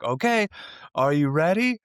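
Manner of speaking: high, loud mock challenge; taunting warning. Truck driver about to blast his horn at her, mock-taunting. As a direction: imitate a driver giving a taunting last warning.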